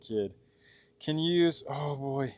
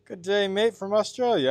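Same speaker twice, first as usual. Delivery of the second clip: put-on higher, playful Australian accent; mock Australian accent; 7 semitones higher, 4 dB louder than usual. Streamer greeting an Australian viewer, in a put-on Australian accent. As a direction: put on a cheery Aussie greeting. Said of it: "sorry for doing that in an accent".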